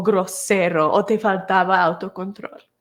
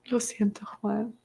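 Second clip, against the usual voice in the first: susurro suave y compasivo; 8 dB quieter than usual. Real, not acted. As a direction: consuela a Juan en voz baja. Consuela a Juan tras su historia. (susurrando a Juan para consolarlo)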